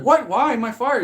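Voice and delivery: high-pitched imitated protest; mock disbelief. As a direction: protest like a baffled employee. Voices Peter reacting to firing.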